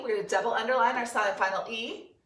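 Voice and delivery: usual speaking voice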